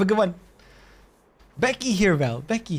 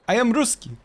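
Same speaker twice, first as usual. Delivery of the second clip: mock Russian accent; 7 semitones higher than usual. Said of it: "Do the Hancock impression".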